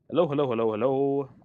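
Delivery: loud quick run of hellos. Real, not acted. Streamer checking if viewers hear better, loud mic-test hellos.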